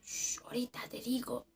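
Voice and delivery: shush, then whisper the rest; voiceless, secretive put-off; hushing whisper. Retold or imitated. Coworker hushing her until later, whispered.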